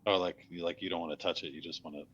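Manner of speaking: ordinary talk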